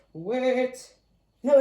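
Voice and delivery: ordinary delivery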